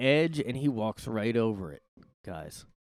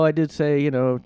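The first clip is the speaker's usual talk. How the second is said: ordinary delivery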